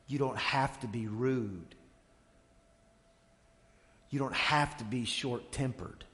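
measured sermon build, each line landing on have; steady pulpit repetition; preaching cadence. Acted. Preaching series of you don't have to.

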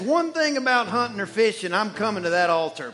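loud, whooping convert; men in the pews cheering, loud and exultant; excited guys. Retold or imitated. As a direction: shout it like a fired-up new believer. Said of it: "All the guys are like".

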